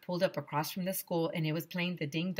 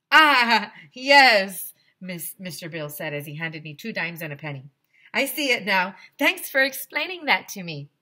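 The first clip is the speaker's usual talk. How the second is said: Mister Bill getting it; 9 dB louder than usual. Acted. Ice cream man thanks the kid, loud and bright. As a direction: say it like the penny dropped. Vendor suddenly understands.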